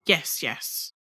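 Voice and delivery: short, soft, uneasy yes; briefly. A narrator's reading. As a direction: mutter it off uneasily. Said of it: "answered very briefly".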